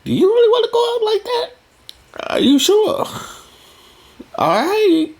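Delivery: a man sceptically questioning a woman's outfit; mocking man voice. Man doubting a woman's outfit, high mocking voice. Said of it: "men will be like".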